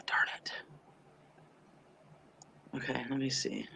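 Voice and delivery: muttered whisper under breath; hushed, frustrated aside. Real, not acted.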